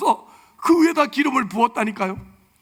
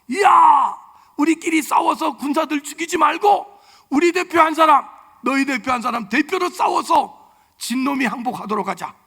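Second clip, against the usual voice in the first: loud, higher taunting challenge; Goliath shouting; 4 semitones higher, 5 dB louder than usual. Retold or imitated. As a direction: bellow the giant's dare across the valley. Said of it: "소리를 지릅니다". (골리앗이 소리 지르며 도발)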